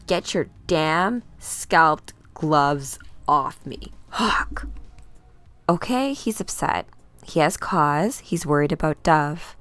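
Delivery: ordinary talk, nothing marked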